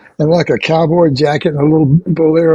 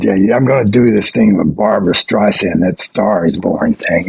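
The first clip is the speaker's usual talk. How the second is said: low-pitched imitation of a hesitant actor; self-doubting friend; 8 semitones lower than usual. Musician retells actor friend's movie plans, low and self-doubting. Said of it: "acting like he couldn't do anything".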